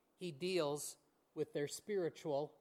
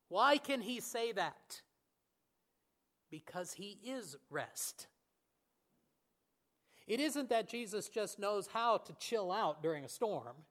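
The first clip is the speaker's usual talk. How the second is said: ordinary talk, nothing marked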